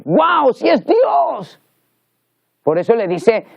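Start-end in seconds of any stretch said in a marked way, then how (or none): 0.0-1.6 high, drawn-out cry of wonder; acting out an astonished discovery, pitch leaping up; acted amazement. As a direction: exclaim it like a sudden revelation. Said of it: "cuando lo ve dice".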